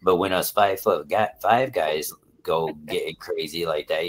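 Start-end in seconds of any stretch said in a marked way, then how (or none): none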